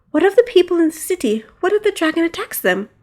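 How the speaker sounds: high worried pleading; frightened. Acted. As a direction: ask it while running scared. Princess fleeing, worried.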